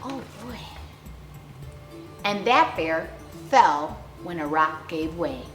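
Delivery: plain reading voice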